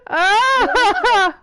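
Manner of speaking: high, loud burst of laughter; laughing shriek. Real, not acted. Listener reacting to the bedtime threat, laughing shriek. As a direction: cackle out a delighted "ah".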